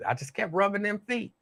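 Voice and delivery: usual speaking voice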